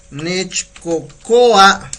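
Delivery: drawn out slowly, every syllable clear; slow model word. Shown as a demonstration. Teacher modelling Nechcocoa slowly for the class. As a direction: stretch Nechcocoa out syllable by syllable for the learners. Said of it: "teacher models Nahuatl for the phrase".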